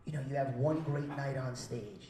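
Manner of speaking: usual speaking voice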